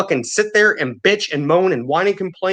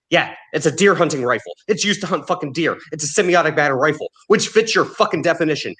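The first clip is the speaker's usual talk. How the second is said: normal talking voice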